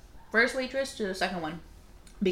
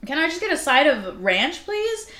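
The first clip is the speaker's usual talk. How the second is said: rising, louder exasperated request; exasperated plea; 7 semitones higher, 6 dB louder than usual. Retold or imitated. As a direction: beg for ranch, fed up. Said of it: "And I was like".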